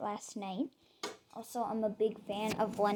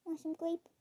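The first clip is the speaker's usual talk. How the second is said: coaxing pet talk; 6 semitones higher than usual. Real, not acted. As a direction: tempt a pet gently with a treat. Offering food to pet rabbit.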